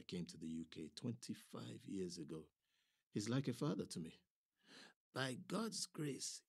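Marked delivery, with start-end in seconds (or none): 5.1-6.4 slow, wheezy words behind an oxygen mask; a sick old man struggling for breath, slowly; wheezing. A narrator's reading. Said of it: "wheezing through his oxygen mask".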